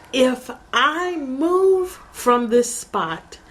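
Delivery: high, slow and drawn out on "If I move"; reenacted slow, high-pitched emphasis on "I move"; retold emphatic reply. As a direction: stretch "If I move from this spot" insistently. Acts out own firm reply.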